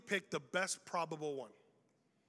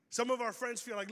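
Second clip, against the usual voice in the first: normal talking voice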